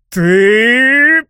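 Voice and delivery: high, loud roar of disbelief. A narrator's reading. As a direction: bellow the word out, furious. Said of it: "проревел Франсуа".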